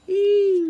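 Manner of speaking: very high, loud long eeee; jet roar. Retold or imitated. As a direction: scream it like a jet overhead. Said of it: "A jet goes".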